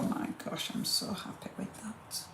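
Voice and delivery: hushed and thrilled, almost to herself; a pleased whisper over her finished craft; delighted whisper. Real, not acted. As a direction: breathe it out in quiet awe. Admiring finished box, whispering.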